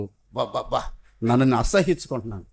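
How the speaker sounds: low, soft and fast self-loathing; retold prayer of shame, low and quick; disgusted groan.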